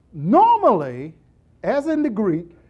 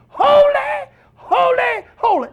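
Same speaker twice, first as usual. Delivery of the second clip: high, shouted "Holy, holy, holy"; loud shout; 14 semitones higher, 4 dB louder than usual. Shown as a demonstration. Seraphims crying out all day long, shouting. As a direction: tear it off the roof. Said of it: "they are tearing it off the roof".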